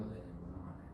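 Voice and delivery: soft, low divine reply; gentle reassurance. Acted. God answers the abandoned man in the story, softly reassuring. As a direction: drop low and hushed to reassure.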